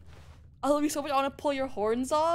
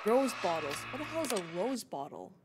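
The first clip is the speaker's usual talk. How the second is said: usual speaking voice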